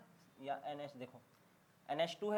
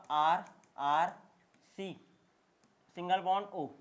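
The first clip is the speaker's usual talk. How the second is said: slow dictation; 6 dB louder than usual. Real, not acted. Teacher dictating a formula.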